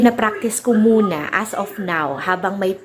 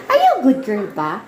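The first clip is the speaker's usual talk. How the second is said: playful mom voice; 5 semitones higher than usual. Real, not acted. Mother teasing daughter about her flat nose, playful.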